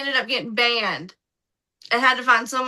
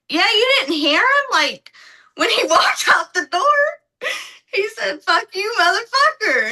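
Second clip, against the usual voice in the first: excited retelling; 8 semitones higher, 4 dB louder than usual. Retold or imitated. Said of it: "And I'm like".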